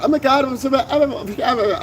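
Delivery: high, gabbled mock wannabe voice; that high octave. Host mimicking somebody he hears talking, high octave voice. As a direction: babble it up high, mocking them. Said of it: "talked in that octave or whatever".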